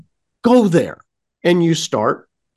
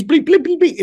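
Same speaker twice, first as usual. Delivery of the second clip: beeping noise; 11 semitones higher than usual. Retold or imitated. Imitating scanner beeps.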